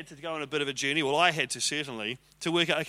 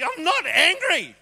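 much higher, louder, slow angry denial; 12 semitones higher, 7 dB louder, slower than usual. Retold or imitated. Husband angrily telling his wife he isn't angry. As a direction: shout that you're not angry. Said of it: "Why are you so angry?".

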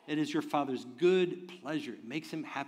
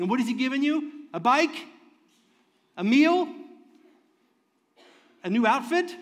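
mock questions; 8 semitones higher, 7 dB louder than usual. Real, not acted. Preacher mocks small gifts.

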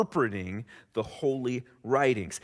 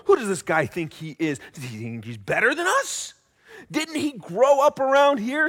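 higher, louder, smug and affronted elite; scribes' thoughts; 6 semitones higher, 4 dB louder than usual. Retold or imitated. Scribes grumbling about Jesus among themselves, indignant and higher. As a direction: sneer like a proud insider whose turf is threatened. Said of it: "The scribes must have been thinking this".

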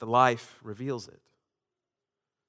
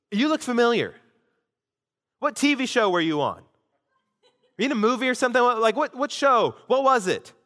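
higher, giddy mock starstruck questions; eager tipsy fan; 8 semitones higher than usual. Tipsy stranger quizzes pastor about his TV show, giddy and higher. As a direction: play the buzzed fan sure she's seen him on TV. Tipsy woman thinks he's famous.

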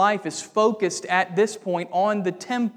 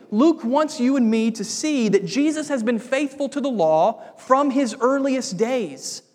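emphatic preaching; 3 semitones higher than usual. Acted. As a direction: preach it to the congregation with building force. Preacher driving home his point.

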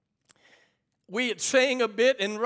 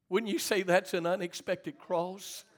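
subdued question; 2 semitones lower, 5 dB quieter than usual. Real, not acted. Preacher asks church after tragic news, subdued question. Rhetorical question, first low.

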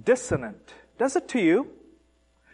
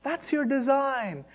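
incredulous taunt; 4 semitones higher than usual. Acted. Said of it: "And the Lord says".

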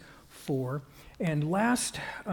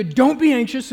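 loud, higher burst; emphatic raised-voice warning; sudden command; 7 semitones higher, 10 dB louder than usual. Acted. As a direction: fire it out as an abrupt order. Acted command to the congregation.

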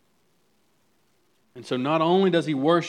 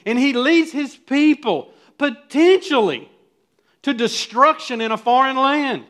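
full-force preaching; 5 semitones higher, 6 dB louder than usual. Acted. Pastor exhorting parents and leaders, preaching at full force. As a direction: rouse the congregation, rising to a climax.

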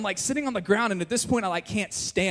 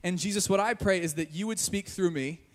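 softer, earnest praying voice; spoken prayer; 4 dB quieter than usual. Real, not acted. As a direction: address God quietly and sincerely. Leads a prayer.

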